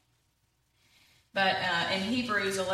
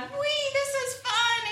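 much higher, delighted squeal of fun; gleeful whee; much slower than usual. Acted. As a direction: fling it out like a kid flying downhill. Walrus finally enjoying ride.

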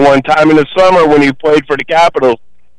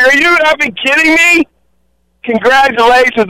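retold, much higher, thrilled disbelief; excited disbelief; 9 semitones higher than usual. Caller retelling meeting his cook friend, excited high disbelief. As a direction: crow it like spotting an old pal.

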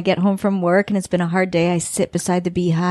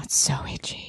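whisper; 9 dB quieter than usual. Real, not acted. Confiding about itchy sting.

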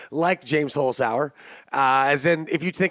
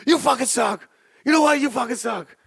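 imitating a screaming coach; 6 semitones higher than usual. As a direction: scream back at the heckler.

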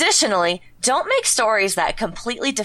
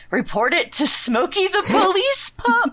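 high goofy voice; 9 semitones higher than usual. Pretend. Reading wiki rules in goofy voice.

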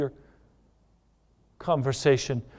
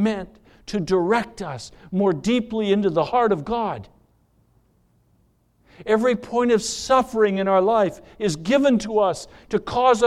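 higher and louder, hammering each phrase; preaching at full force; 8 semitones higher, 6 dB louder than usual. Acted. Preacher telling the church suffering draws them to God, fervent and loud. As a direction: build it like a sermon climax.

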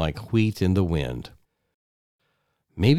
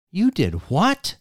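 stunned disbelief; 9 semitones higher than usual. Acted. Wife stunned by his quitting.

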